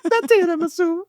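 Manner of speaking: high, far-off Italian flourish; mock Italian accent. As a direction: sing out the word Italian-style. Host mimics Italian voice again.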